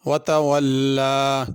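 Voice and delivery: वतवल्ला with the long aa after the lam drawn out like alif; long a in Quran reading. Shown as a demonstration. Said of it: "लाम के ऊपर खड़ी ज़बर है, इसको अलिफ की तरह पढ़ना, ज़रा खींच के".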